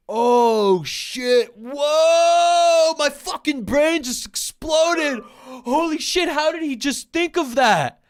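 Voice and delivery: hammed-up astonished shout; mock mind blown. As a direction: yell in fake awe at a dumb idea. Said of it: "sarcastic mock amazement".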